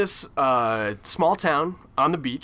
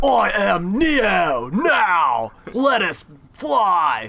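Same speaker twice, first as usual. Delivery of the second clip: very slow, loud grand action-hero voice; mock Neo; 4 semitones higher, 4 dB louder, much slower than usual. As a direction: drag out every word like a hammy Neo. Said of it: "weird Keanu Reeves impersonation".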